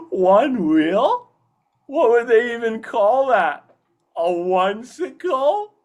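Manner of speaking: high, slow cartoon voice, wondering aloud; cheeky Wayne. Actor voices cheeky Wayne naming a one-wheeler, high and loud. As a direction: puzzle it out with a grin. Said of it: "I love cheeky Wayne".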